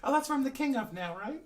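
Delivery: self-styled king. Acted. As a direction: claim the throne in a high-pitched voice. Plays a character as king.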